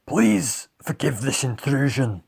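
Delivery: put-on warthog storybook voice; warthog character. A narrator's reading. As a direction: pitch it up as a polite warthog. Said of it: "the Warthog began".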